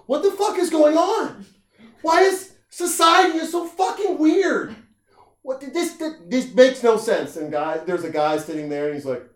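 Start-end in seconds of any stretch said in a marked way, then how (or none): 0.1-7.3 high, loud, outraged woman's cry; exasperated woman. Retold or imitated. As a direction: shriek it like a fed-up woman. Angry woman voiced in rant.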